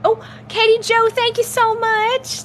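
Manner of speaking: high-pitched, gushing thanks; excited thanks. Real, not acted. Streamer thanking viewer for a gift, excited. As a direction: squeal thanks, stretching the end.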